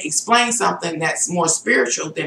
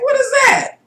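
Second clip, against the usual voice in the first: much higher, alarmed question; can't believe what you feel; startled. Retold or imitated. Startled by her hunger.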